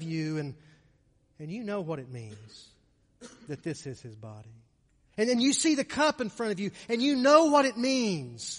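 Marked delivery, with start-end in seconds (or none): 5.2-8.6 high, raised voice building to a climax; impassioned preaching. Real, not acted. Preacher showing the congregation the cup, impassioned preaching. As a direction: proclaim it with growing fervour.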